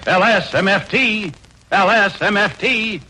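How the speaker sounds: normal talking voice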